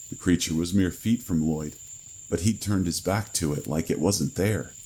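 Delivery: plain reading voice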